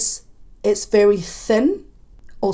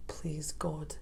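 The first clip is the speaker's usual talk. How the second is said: soft unvoiced plea to God; whispered plea; 5 semitones lower, 17 dB quieter than usual. Real, not acted. Reacting to the song, a whispered plea. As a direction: breathe it as a little prayer. Dreads a gospel choir version.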